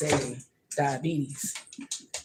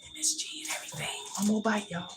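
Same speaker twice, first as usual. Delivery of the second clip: tempting whisper; 5 semitones higher, 6 dB quieter than usual. Pretend. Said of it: "M S G has always been whispering".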